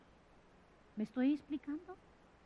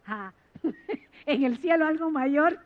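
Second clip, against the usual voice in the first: laughing while answering; laughing gently to her child; 7 dB louder than usual. Retold or imitated. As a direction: chuckle through the words. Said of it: "me río y le digo".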